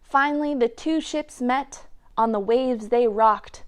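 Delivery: ordinary delivery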